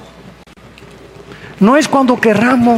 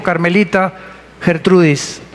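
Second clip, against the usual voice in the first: usual speaking voice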